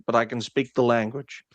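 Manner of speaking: ordinary talk, nothing marked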